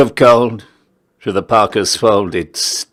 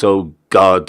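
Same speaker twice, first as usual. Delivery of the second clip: ordinary delivery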